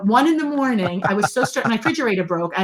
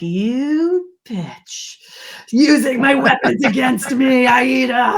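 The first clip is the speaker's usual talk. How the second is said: higher, louder playful indignation; mock outrage; 4 semitones higher, 4 dB louder, much slower than usual. Friend Aida turns her own trick on her, mock angry shout. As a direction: fake fury at being outsmarted.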